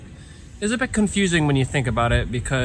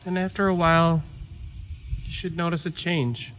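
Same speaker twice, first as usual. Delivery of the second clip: calm meditation guide with long pauses; guided meditation; 8 dB quieter than usual. Real, not acted. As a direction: ease listeners inward, leaving silence between phrases. Leads a breathing meditation.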